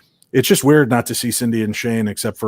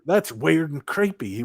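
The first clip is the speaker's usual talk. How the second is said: slow, higher mock Southern drawl; 5 semitones higher, 5 dB quieter, slower than usual. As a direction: stretch the words in an imitated Southern accent. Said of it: "that little southern drawl popping in there".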